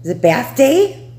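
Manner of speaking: slow, loud question rising high; excited pet talk. Real, not acted. Owner coaxing a hiding dog toward the bath, excited pet talk. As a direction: hype up the dog, pitch climbing.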